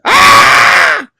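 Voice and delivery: loud, high comic scream; hammed-up "Ah!" at breaking point; exasperated scream. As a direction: burst into a frustrated mock scream. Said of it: "I think I'm going to scream".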